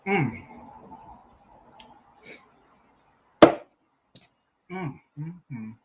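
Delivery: humming through a bite; chewing while talking. Real, not acted. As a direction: mouth full of food. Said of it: "I'm trying to eat".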